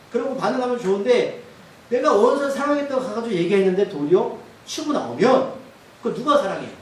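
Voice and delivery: ordinary talk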